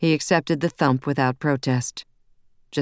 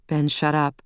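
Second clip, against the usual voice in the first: ordinary delivery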